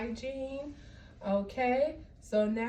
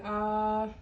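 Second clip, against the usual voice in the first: saying ahh; 3 dB louder than usual. Shown as a demonstration. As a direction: open wide and say ahh.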